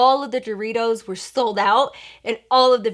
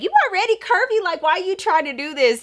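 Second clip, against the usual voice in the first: incredulous protest; 8 semitones higher than usual. Retold or imitated. Telling sister she's curvy already.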